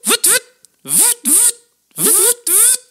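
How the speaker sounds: very high, slow wailing siren; fire siren imitation. Shown as a demonstration. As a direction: wail like a fire truck siren. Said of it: "une, une sirène de pompier".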